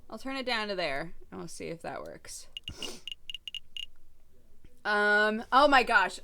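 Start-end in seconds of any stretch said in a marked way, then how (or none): 1.3-2.5 whispered aside to herself; a soft unvoiced murmur. Real, not acted. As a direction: drop to a whisper while fiddling with the volume. Adjusting volume, aside.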